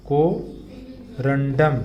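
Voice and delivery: very slow drawn-out. Shown as a demonstration. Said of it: "आप साथ साथ बोलेंगे".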